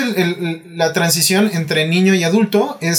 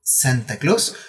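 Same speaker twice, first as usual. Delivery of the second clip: put-on slow, sing-song voice for children; giggly sinister sing-song; 2 semitones higher than usual. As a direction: sweetly break the bad news, slowly. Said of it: "nosotros le podemos decir".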